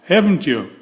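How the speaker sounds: ordinary delivery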